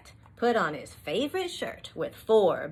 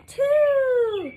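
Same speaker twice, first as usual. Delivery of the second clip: high, loud count; excited answer; 8 dB louder than usual. Acted. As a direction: call out the answer. Answering counting question loudly.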